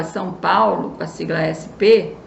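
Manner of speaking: ordinary talk, nothing marked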